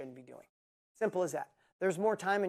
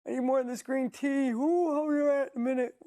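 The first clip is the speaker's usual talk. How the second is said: high, loud whiny tired voice; hammed-up sleepy complainer; mock tired whiner; 8 semitones higher, 7 dB louder than usual. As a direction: mimic a sluggish person begging for tea. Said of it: "You're never gonna say".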